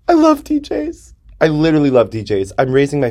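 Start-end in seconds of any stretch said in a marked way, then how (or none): 0.1-1.1 very high, slow squeal of adoration; giddy. Real, not acted. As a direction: squeal it slowly, giddy with love. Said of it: "I get little giddy".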